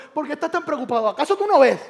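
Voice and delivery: loud, high incredulous challenge; challenging cry. Acted. Jesus rebuking a worried believer, loud and high. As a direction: demand why they doubt.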